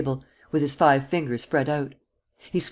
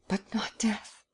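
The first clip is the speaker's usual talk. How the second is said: softer frightened whisper; terrified whisper; 7 semitones higher, 7 dB quieter, slower than usual. Acted. Young man whispers his fear of death to the prince. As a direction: breathe it out in dread.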